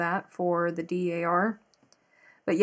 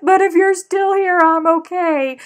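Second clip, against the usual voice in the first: crying with relief; 10 semitones higher, 11 dB louder than usual. Retold or imitated. Teen girl calling her nanny at night, sobbing with relief. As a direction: sob it out like the world just ended and didn't. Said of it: "I said".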